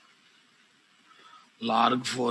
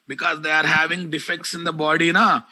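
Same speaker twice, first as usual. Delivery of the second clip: angry scolding; 4 semitones higher than usual. Real, not acted. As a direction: call him out angrily by name. Teacher scolding and calling out student.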